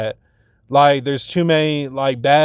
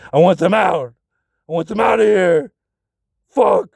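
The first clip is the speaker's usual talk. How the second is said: strained, higher-pitched villainous outburst; Anakin; 4 semitones higher than usual. Retold or imitated. Telling someone to get strangers out of the house, like Anakin. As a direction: act out an anguished, rising demand. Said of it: "talking like Anakin".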